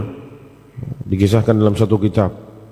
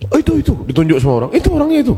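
much higher, pointing voices of the crowd; eager bystanders; 11 semitones higher, much faster than usual. Acted. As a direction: call out like people in a busy market. (suara orang ramai menunjuk dengan nada tinggi)

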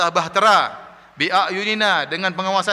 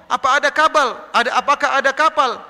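imitated scoffer's raised, incredulous jibe; mocking taunt; 7 semitones higher, 3 dB louder than usual. Another scoffer questions Nuh about a ship on land, mocking. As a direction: jeer at him in a higher voice. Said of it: "Ada lagi ngejek".

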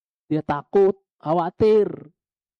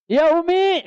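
high, loud shouted reply; loud answering call; 5 dB louder, slower than usual. Retold or imitated. Preacher voicing the son answering his mother too loudly. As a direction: answer her louder than she called. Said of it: "Lebih kencang nyautnya".

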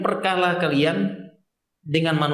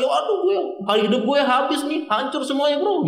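higher, hammed-up whining lament; mock despairing whine; 8 semitones higher than usual. Believer whining to a friend, hammed-up despair. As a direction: play the drama queen crying about ruin. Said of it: "dia sudah ndak gaya-gaya nangis lagi".

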